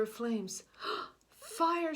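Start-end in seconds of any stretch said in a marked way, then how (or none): 1.4-1.9 high, loud alarm; crying out. Acted. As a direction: shout the warning. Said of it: "she cried".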